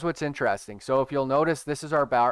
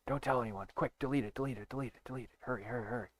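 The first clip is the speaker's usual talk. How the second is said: much softer hurried hush; mock hushed panic; 12 dB quieter, faster than usual. Urging viewers to delete it, in a hushed mock panic. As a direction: urgently hush as if caught.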